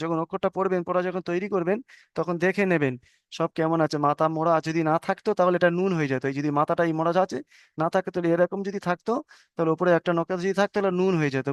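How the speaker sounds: usual speaking voice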